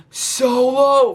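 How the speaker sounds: high, drawn-out call; mock yell, pitch pushed up; imitated yell. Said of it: "and then he yells".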